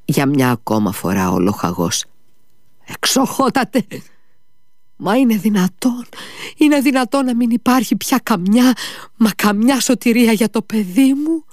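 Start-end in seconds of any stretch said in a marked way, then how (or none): 2.8-11.4 put-on father begging the doctor to save his child; desperate plea. A narrator's reading. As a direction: cry out for your child's life.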